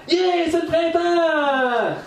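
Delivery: high, slow, stretched cheer; excited yell. Retold or imitated. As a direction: belt out the joy of spring. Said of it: "cinq qui ont crié ça".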